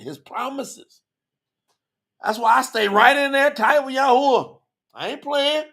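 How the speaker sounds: high, driving sermon voice; fervent preaching. Real, not acted. Preacher urging listeners to keep the commandments, fervent and high. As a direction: preach it hard, pitched well up.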